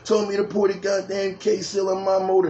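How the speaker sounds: put-on high-pitched griping young guy; mock aggrieved whine about bad advice; imitated complaint. Acting out the young man's complaint.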